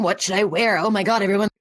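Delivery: usual speaking voice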